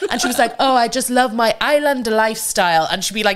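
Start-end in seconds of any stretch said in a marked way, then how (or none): 0.6-2.9 high-pitched fake Greek accent; Lindsay Lohan. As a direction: do a bad celebrity attempt at a Greek accent. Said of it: "she was trying to be Greek".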